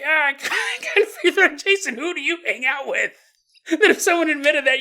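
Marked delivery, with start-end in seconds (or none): 0.0-3.1 mock knowing. Mock friend reacting to confession.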